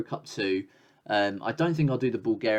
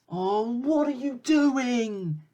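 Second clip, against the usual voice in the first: high, slow, disbelieving cry; exasperated yell; 11 semitones higher, much slower than usual. Real, not acted. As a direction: drag it out in sheer disbelief. Disbelief at terrible defending.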